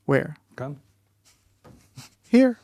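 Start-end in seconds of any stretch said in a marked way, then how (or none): 2.3-2.6 a sudden high, loud "Here."; emphatic here. Real, not acted. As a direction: punch "Here." hard, high and loud as the answer. The one place he failed.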